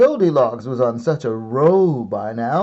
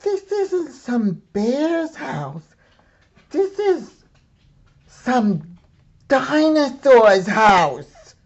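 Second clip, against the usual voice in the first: put-on much higher, slow, alarmed little girl voice; girl realising; 13 semitones higher, 4 dB louder, slower than usual. A narrator's reading. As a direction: spell out the discovery, wide-eyed. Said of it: "she told herself".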